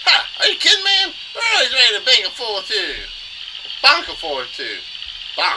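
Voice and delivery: loud, high cartoon tough guy; cocky Goomba fighter. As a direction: boast like a cartoon brawler.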